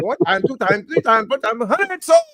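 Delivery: high-pitched rapid auction patter; auctioneer voice. Retold or imitated. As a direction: call the bids like an auctioneer.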